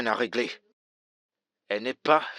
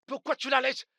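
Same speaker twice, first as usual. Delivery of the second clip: rising shout; 12 semitones higher than usual. Acted. Husband yells at pastor, rising.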